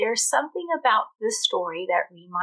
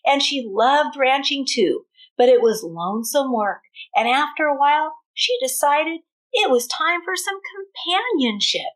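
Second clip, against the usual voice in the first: lively read-aloud with a country twang; storybook reading; 5 semitones higher, 6 dB louder than usual. A narrator's reading. Teacher reading a cowboy tale to her class, lively twang.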